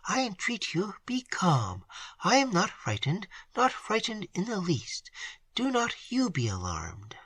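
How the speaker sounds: soft, hushed comfort fading to whisper; steady the aunt despite her own fear; soothing reassurance. A narrator's reading. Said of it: "turning to her aunt".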